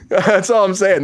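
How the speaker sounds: ordinary talk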